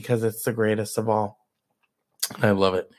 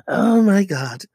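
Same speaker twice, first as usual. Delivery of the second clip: normal talking voice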